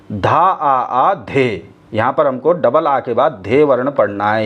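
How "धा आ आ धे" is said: "धा आ आ धे" recited very slowly, both आ sounds held; slow teacher's demonstration of the bols; slow recitation. Shown as a demonstration. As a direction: draw out the syllables for the students. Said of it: "दो आ का उच्चारण करना है".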